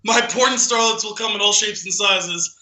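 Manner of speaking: ordinary delivery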